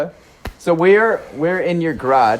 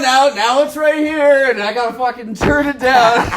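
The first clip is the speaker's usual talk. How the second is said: hammed-up annoyed self at the editing desk; mock frustrated editor; 12 semitones higher, 3 dB louder than usual. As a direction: whine it like a fed-up editor, a bit laughing. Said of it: "I'm gonna have that".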